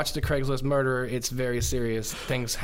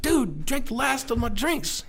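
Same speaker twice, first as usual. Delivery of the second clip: slow, high-pitched mock accusation; lightly voiced accusation; 10 semitones higher than usual. Accusing the fisherman roommate, lightly mock-slow. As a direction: drag out the blame. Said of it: "I was like".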